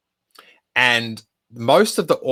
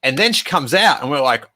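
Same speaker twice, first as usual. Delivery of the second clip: ordinary talk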